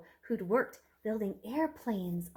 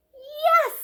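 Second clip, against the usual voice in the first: much higher, louder yes; emphatic yes; 11 dB louder than usual. Acted. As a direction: burst in with a cheerful yes. Aunt answers brightly.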